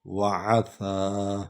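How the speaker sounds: وَعَصَى slow, with the ص vowel drawn long; وَعَصَى, long open a on saad; lengthened saad. Shown as a demonstration. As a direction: stretch the vowel after ص in وَعَصَى. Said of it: "اس کو ذرا لمبا کرنا ہے".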